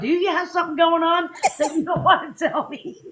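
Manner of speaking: lightly voiced husband, laughing; husband echoing the tease with a laugh; husband teasing, laughing. Husband teasing his wife, laughing. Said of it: "he's going".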